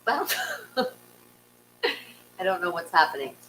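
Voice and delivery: ordinary delivery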